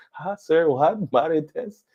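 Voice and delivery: high-pitched, puzzled echo of the question; baffled store clerk. Retold or imitated. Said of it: "And they're like".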